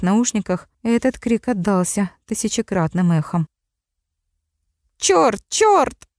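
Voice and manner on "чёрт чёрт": strangled cry. A narrator's reading. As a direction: cry out in pain. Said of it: "сдавленный крик".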